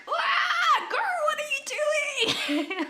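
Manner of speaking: very high, drawn-out squeal breaking into laughter; exasperated shriek. Acted. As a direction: shriek at the heroine in hammed-up disbelief. Yelling at passive drama heroine.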